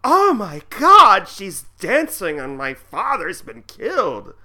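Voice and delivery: drawn-out high mock horror; shocked Margaret. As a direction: play her stunned and scandalized. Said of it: "she's like".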